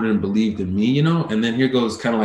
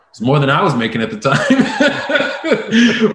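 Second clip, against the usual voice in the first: slow, louder, chuckling through the words; laughing while talking; 5 semitones higher, 5 dB louder, slower than usual. Real, not acted. Telling the host about the man's pay, laughing as he speaks. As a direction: laugh it out at your own joke.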